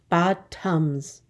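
"Bottoms" split in two, bot-toms; syllable by syllable. Shown as a demonstration. Teacher counting two syllables, "Bottoms" said in parts. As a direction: say "Bottoms" as two separate beats. Syllable lesson, word broken up.

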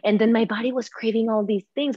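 normal talking voice

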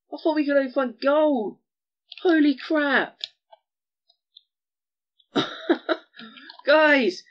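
high, loud, thrilled yelling; excited shouting. Real, not acted. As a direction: shriek with disbelief at the find. Finds diamonds, shouting.

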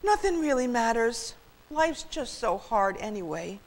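high start sinking wearily; sighing. A narrator's reading. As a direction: sigh it out as if giving up. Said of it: "he sighed".

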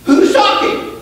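high, slow, ringing preacher voice; fiery preaching. Real, not acted. Pastor urging the church to know God's voice, fiery preaching. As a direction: preach it to the back row.

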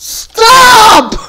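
high, loud anguished shout; screamed plea. Real, not acted. Player killed repeatedly, screamed plea to stop.

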